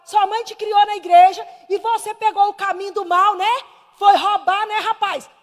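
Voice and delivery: loud, high-pitched retold rebuke; forceful preaching reproach; scolding with gusto. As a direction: re-enact telling off a wayward young man. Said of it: "Aí eu falei com gosto".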